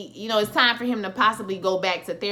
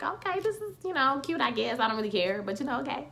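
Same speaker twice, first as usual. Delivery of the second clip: unimpressed; 5 semitones higher, 5 dB quieter than usual. Retold or imitated. Unimpressed reaction to TV scene.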